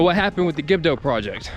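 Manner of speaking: usual speaking voice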